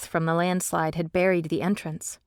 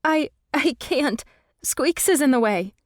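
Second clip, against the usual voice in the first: higher, halting, relieved near tears; shaky relief after the cave-in; voice cracking; 7 semitones higher, 5 dB louder than usual. A narrator's reading. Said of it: "My voice cracked".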